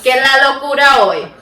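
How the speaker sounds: a touch of a high, loud host voice; show greeting voice. Pretend. Opening the episode, lightly voiced loud host. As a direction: toss the question out to the room. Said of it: "That was good".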